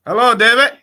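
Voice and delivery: loud, high-pitched on-air greeting; calling out to caller. Real, not acted. Host greets a reporter phoning in, calling out loudly. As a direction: project a big welcome down the line.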